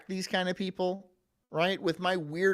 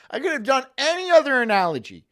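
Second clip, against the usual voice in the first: louder and higher, scolding himself; loud self-exasperation over a bad analogy; exasperated outburst; 3 semitones higher, 7 dB louder than usual. Real, not acted. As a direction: cry out at your own bad choice. Embarrassed at his own analogy.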